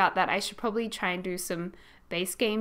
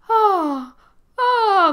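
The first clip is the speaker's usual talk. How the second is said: high, loud cooing oh, oh; mum's cooing; 11 semitones higher, 9 dB louder than usual. Retold or imitated. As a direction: coo like a charmed mum. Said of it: "she was going".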